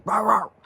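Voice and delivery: loud high mock barking; imitated bark. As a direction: bark it out like a big dog. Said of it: "like, barks really".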